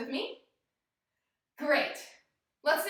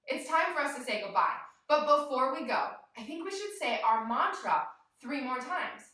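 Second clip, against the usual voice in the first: ordinary delivery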